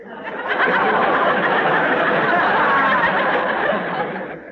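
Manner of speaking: high airy ghoulish laugh; eerie breathy cackle; creepy undertaker chuckle. Acted.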